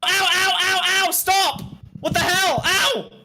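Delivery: high, rapid-fire pained yelps; acting erratic. Streamer claims someone is pushing through, high frantic yelping. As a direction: yelp in panic as the blows land. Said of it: "You're acting so erratic".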